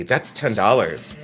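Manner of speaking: low, slow, calm price call. Shown as a demonstration. Host quoting a price after promising calm, calmly. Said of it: "Look how calm I can be".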